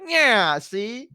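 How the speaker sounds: high cartoon gangster voice, dropping off; mafia henchman. Imitating the giant toes' henchmen, mock gangster voice. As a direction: play a mock movie mobster. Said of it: "They were like a mafia group".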